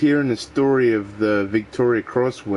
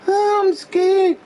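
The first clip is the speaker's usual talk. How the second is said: high, slow, hammed-up frightened squeak; mock scared; 3 dB louder than usual. Mocking cowardly men with a hammed-up scared squeak.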